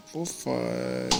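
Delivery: ordinary delivery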